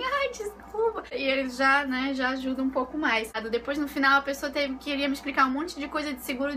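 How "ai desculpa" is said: higher, slow, sugary little sorry; sweet apology. Retold or imitated. Woman sweet-talks the car inspector, high and slow. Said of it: "vai dar um sorrisinho".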